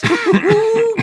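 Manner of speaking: short, high, loud cackle; excited cackle. Acted. Villain thrilled by the toxin, high cackle. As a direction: burst into a gleeful cackle.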